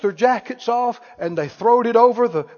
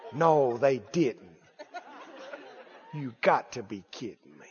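gleeful gossip; 10 semitones lower, 11 dB quieter than usual. Pretend. Gossip listener reacting gleefully.

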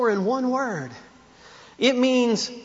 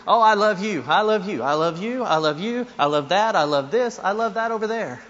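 frivolous love talk. Retold or imitated. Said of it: "throw it out frivolously".

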